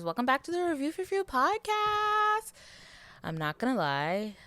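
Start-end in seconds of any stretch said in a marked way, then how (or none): 0.0-2.5 bright podcast opener at full energy; excited intro. Acted. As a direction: greet the listeners like a hyped host.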